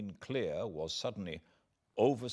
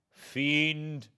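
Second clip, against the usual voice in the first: high, loud cry of despair; exclaimed; 8 semitones higher, 5 dB louder than usual. A narrator's reading. Victor cries out to the fiend at sea, loud and despairing. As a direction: hurl it at the fiend. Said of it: "I exclaimed".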